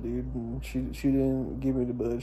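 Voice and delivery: usual speaking voice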